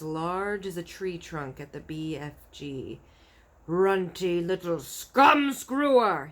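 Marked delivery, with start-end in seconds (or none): 3.7-6.3 slow, loud insulting giant; furious giant shout. A narrator's reading. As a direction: bellow the insult. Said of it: "he shouted".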